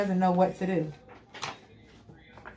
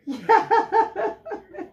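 cackling laugh; 13 semitones higher, 3 dB louder than usual. Real, not acted. Laughs at own reading.

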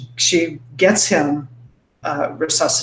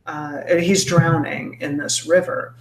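ordinary talk, nothing marked

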